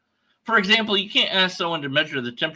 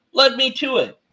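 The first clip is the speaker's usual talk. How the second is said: normal talking voice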